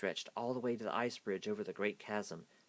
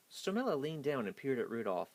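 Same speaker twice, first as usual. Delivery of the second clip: ordinary delivery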